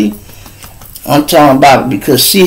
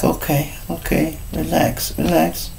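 whispered, soft reassurance; hushed calming; 13 dB quieter than usual. Retold or imitated. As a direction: softly talk her down, almost a whisper. Medic calming her quietly.

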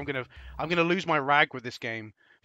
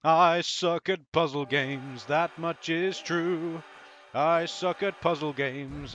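slow mock confession; 3 semitones higher, much slower than usual. Mock-solemn self-confession.

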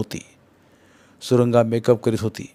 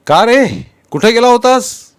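loud, much higher stern call; sharp surprised rebuke from the uncle; exclaiming uncle; 10 semitones higher, 9 dB louder, slower than usual. A narrator's reading. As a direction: bark it out as an angry elder. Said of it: "ते उद्गारले".

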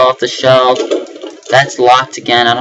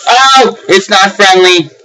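panicked shout; 6 semitones higher, slower than usual. Real, not acted. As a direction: yelp in panic at the creature. Monster chases player.

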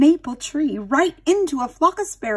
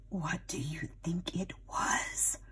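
whisper; 5 semitones lower, 14 dB quieter, slower than usual. Shown as a demonstration. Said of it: "aside to young listeners".

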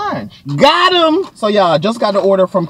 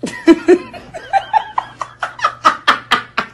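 big laugh. Real, not acted. Laughing at her own coffee pun.

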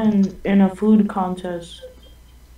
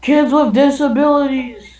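higher, wavering yelled outburst; mock yell; 6 semitones higher than usual. Butting into the friends' planning, high mock yell. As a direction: blurt it out in a raised voice. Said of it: "that just yells".

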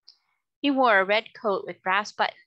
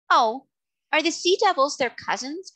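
put-on high, louder childlike surprise; surprised question; 7 semitones higher, 5 dB louder than usual. A narrator's reading. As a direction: ask in sudden wonder.

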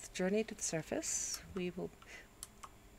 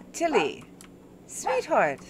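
calling the dog; 3 semitones higher, 5 dB louder than usual. Real, not acted.

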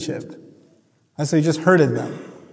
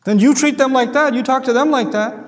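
ordinary talk

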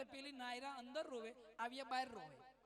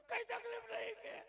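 whiny reply; 9 semitones higher than usual. Retold or imitated. Widower answers the angry villagers.

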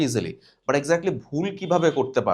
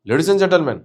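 loud, forced push on the words; pressed reading; 3 semitones higher, 7 dB louder, much slower than usual. Shown as a demonstration. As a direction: bear down hard on the whole phrase. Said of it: "এভাবে চাপ দিয়ে পড়ে". (চাপ দিয়ে জোরে পড়া)

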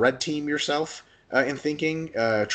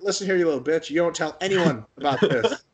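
higher, hammed-up tough-guy threat; mock threat; 6 semitones higher than usual. Stumped lifter jokingly threatening the kid, mock menace. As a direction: playfully menace the kid into silence.

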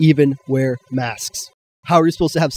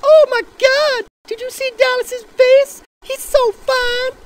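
very high, slow gushing fangirl voice; swooning girls; slower than usual. Pretend. Mocking girls swooning over Dallas's face, high gush. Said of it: "when they go".

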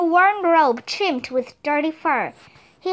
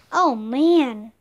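ordinary delivery, nothing marked

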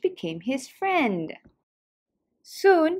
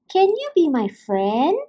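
hopeful little fish voice; 4 semitones higher, 3 dB louder than usual. Acted. Fish asks sea turtle.

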